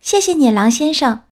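put-on little girl voice. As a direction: play a polite little girl. Girl answers the wolf. (小女孩的声音，音调偏高)

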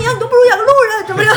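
fast, high, loud mocking rant with a laugh; mother mocking her son's uselessness; mom scolding. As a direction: rattle it off like an exasperated mother. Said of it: "我妈说".

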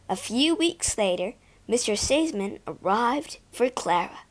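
plain reading voice

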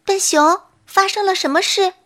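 a gentle fawn checking on a friend; little deer asking the bear what happened, high and concerned. A narrator's reading. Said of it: "关心地问".

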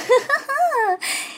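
high gloating fox chuckle; a smug fox cackle; sly fox laugh. A narrator's reading. Fox laughs over his prey. (高而得意的狐狸笑声)

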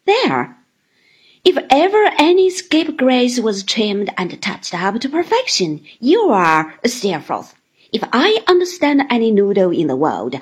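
loud, brisk little woman, scolding playfully; fond mock scolding while trimming his hair; Miss Mowcher.

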